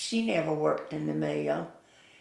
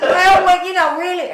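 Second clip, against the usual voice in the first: real high, very loud outburst; amused exclamation; 14 semitones higher, 14 dB louder than usual. Real, not acted. Storekeeper asked about competing with Leon, amused outburst. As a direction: burst out high and loud before explaining.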